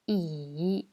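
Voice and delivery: "I" dipping low, then rising; third tone. Shown as a demonstration. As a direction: dip down on "I" and come back up. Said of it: "je répète les quatre tons".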